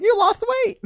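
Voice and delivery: very high mock relative. Relative repeating her compliment, very high and mocking. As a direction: squeal it like the old aunt. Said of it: "She's like".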